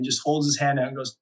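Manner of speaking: ordinary talk, nothing marked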